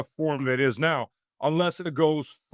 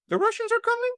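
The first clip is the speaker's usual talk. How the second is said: much higher, put-on voice asking with a rise on are; mock alarm; faster than usual. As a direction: squeak it like a panicked doom-monger asking. Mocking the Russian Scare.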